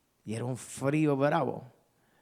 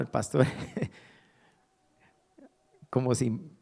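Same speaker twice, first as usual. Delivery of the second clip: normal talking voice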